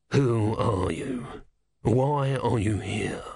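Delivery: menacing deacon. Acted. Deacon interrogates Ern.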